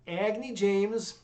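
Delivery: usual speaking voice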